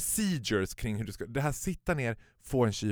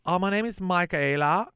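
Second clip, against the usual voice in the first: mock customer; 8 semitones higher, 5 dB louder than usual. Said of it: "Hon bara".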